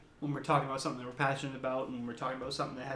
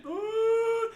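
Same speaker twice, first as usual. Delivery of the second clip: very high loud ghostly howl; high ghost-woman howl; imitated howl; 6 dB louder than usual. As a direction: wail like a howling dog. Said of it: "then I hear it again".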